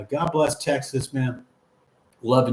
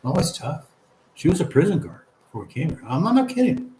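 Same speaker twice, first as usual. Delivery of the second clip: ordinary delivery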